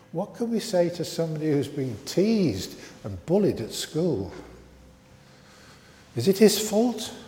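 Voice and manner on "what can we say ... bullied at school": ordinary delivery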